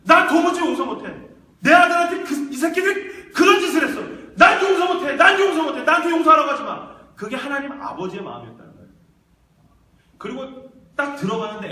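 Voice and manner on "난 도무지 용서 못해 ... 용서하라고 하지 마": full-force high shout of outrage; acted raging refusal, very loud and high; furious anguished father. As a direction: roar out a parent's unbearable pain. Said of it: "하나님 아버지가 그 마음이었다는".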